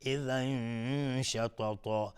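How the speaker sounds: "إذا شططا" slow, hidden n hummed long before sh; ikhfa of tanwin. Shown as a demonstration. Teacher shows ikhfa, "إذا شططا" with long nasal hold. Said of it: "اخفای حقیقی".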